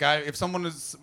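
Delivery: normal talking voice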